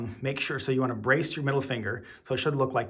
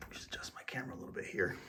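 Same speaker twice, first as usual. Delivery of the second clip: whisper; 4 semitones lower, 13 dB quieter, faster than usual. Real, not acted. Aside while fixing the camera.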